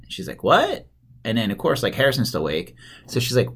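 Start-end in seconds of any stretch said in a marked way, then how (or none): 0.4-0.9 high rising yelp of disbelief; baffled disbelief. Retold or imitated. Wife hears of Santa's fire truck, baffled high question. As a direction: pitch shoots up, incredulous.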